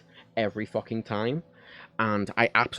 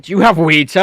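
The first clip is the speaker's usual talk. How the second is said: imitated officer; 7 semitones higher, 15 dB louder than usual. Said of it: "someone's like".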